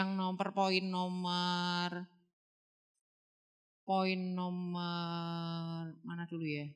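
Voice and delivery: slow, low, drawn-out murmur; trailing along quietly in a half-monotone; absent-minded searching. Real, not acted. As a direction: stretch the words while hunting for your place. Stalls while looking for the point.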